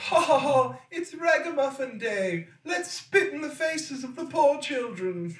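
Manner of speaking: high, loud mocking laugh; snooty rich kid. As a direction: cackle like a spoiled rich brat. Voicing cruel rich kids.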